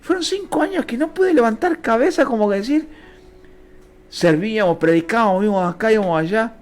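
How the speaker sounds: impassioned preaching. Acted. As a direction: pour out the hard years to the congregation. Preaches hardship testimony, raised.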